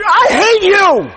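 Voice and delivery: high, loud, drawn-out yell of hate; hammed-up high shriek of frustration; mock shout. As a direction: scream it at his back in mock fury. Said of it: "And I was like".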